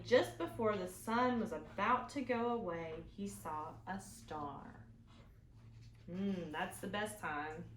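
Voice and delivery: plain reading voice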